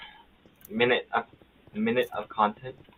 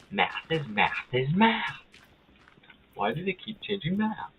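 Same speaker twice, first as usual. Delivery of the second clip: meme voice; 9 semitones higher, slower than usual. Retold or imitated. Quoting a meme voice.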